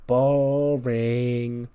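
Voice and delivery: long, drawn-out single word; bored heckle. Acted. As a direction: stretch it out as a sleepy jeer. Sleepy heckler interrupts a presentation.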